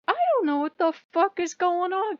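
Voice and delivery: high, loud confused outburst; baffled. Retold or imitated. Torn between gods, a high confused outburst. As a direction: throw up your hands, high-pitched. Her own quoted reaction.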